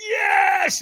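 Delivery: very high, loud drawn-out yell; acted all-out battle cry; triumphant scream. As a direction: scream it with hands in the air. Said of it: "I threw my hands in the air and I screamed".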